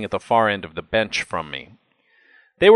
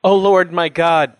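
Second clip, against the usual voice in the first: high, loud cry to God; anguished cry; 8 semitones higher, 7 dB louder than usual. A narrator's reading. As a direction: cry out as a dying man in agony. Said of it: "In anguish, Joseph cried".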